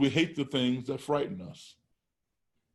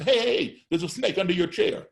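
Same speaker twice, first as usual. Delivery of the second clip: much higher and louder, a fake warning shouted at the front row; sudden alarmed call; 7 semitones higher, 4 dB louder than usual. Teacher pranking a classroom of kids, loud alarmed mock warning. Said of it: "I'll say".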